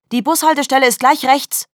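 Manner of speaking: high, loud mocking call; calling after him. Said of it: "rief Pia ihm nach". (laut und hoch hinterhergerufen, spöttisch)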